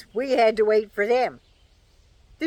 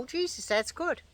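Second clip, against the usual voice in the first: pleasantly surprised; 5 semitones higher, 8 dB quieter than usual. Retold or imitated. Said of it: "they taste and go".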